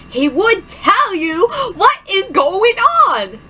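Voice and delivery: loud, slow indignant words; angry friend. Acted. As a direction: stand up for her angrily.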